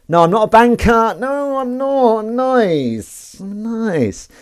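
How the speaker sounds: high, drawn-out wail; mock wailing protest. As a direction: stretch the vowels high in hammed-up protest. Mock protest at being called banker.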